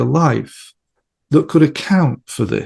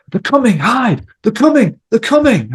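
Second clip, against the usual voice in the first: panicked warning; 10 semitones higher, 4 dB louder than usual. Retold or imitated. Client reliving a terror in trance.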